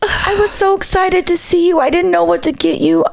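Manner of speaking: high, breathless-with-joy gushing; squeaky excited fan voice; imitated excited fan. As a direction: mimic a starstruck fan pouring it out.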